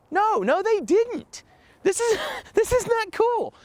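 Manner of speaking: exasperated protest. Retold or imitated. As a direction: protest in a high disbelieving voice. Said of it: "And I'm like".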